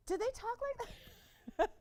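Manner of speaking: high-pitched question breaking into laughter; squeaky, amused astonishment; laughing disbelief. Real, not acted. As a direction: ask it incredulously, cracking up. Amused disbelief at colleague's phone manner.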